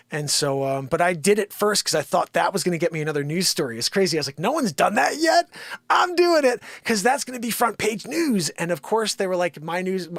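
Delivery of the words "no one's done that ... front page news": high, eager self-quote; selling the big idea to himself; excited. Retold or imitated. Realtor recalling his own excitement, higher and eager.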